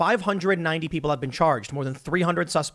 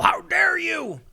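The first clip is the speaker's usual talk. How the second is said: much higher, indignant how dare you; retold outraged demand; 13 semitones higher, much slower than usual. Officer slamming the table at congressmen, indignant outburst. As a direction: hurl it back like an insulted officer. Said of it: "One of the officers was outraged".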